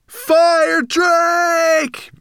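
long, high, drawn-out yell; stretched-out desperate shout; shout at full voice. A narrator's reading. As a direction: bellow the name as loud as possible. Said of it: "shouted Ben as loud as he could".

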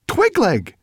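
high, loud exclamation; surprised cry. A narrator's reading. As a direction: cry the name out in astonishment. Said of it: "cried Ben".

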